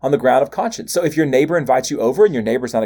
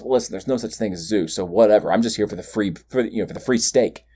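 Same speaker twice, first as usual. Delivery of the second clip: casual excuse-maker; 5 semitones lower than usual. Retold or imitated. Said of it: "Christians can't tell themselves".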